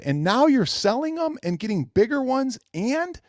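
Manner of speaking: high, exasperated disbelief; incredulous rant. Retold or imitated. Calling out fans for switching sides, high exasperation. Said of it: "I'm going".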